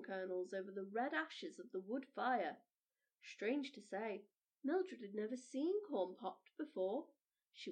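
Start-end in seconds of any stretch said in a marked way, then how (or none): none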